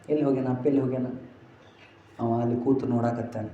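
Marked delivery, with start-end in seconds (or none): 0.1-1.1 put-on grieving child. Grieving girl asks her mother.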